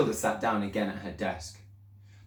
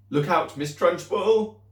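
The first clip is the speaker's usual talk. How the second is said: higher, louder, slow warning cry; 9 semitones higher, 6 dB louder, slower than usual. Acted. Girl crying a warning to the headmistress. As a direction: call out a warning to her. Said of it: "cried Lavender".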